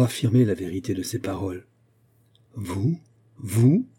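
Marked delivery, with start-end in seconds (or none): 2.6-3.0 understated incredulous question, rising; disbelieving question. A narrator's reading. Mother thinking her daughter mad, understated rising disbelief. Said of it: "répéta la princesse, qui crut sa fille folle". 3.4-3.9 stronger disbelief, sharply rising question; incredulous repeat. A narrator's reading. Mother thinking her daughter mad, astonished rising repeat. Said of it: "répéta la princesse, qui crut sa fille folle".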